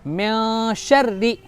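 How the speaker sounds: म्या शर्री slow, long nasal first syllable, light rr; measured recitation with thin rr in शर्री; thin doubled ra. Shown as a demonstration. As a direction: hold म्या, then a fine doubled र into री. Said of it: "राय मुशद्ददा को बारीक करके पढ़ेंगे".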